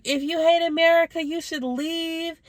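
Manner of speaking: a shouted jab that slows and drags at the end; mock angry yell. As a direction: ham up a ranting man telling her to leave. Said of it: "started freaking out".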